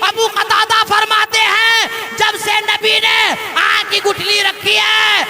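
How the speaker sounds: loud, ringing sermon delivery, rising in pitch; impassioned preacher's cry; full-force preaching. Acted. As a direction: preach the miracle story to a big crowd, building to a drawn-out high climax.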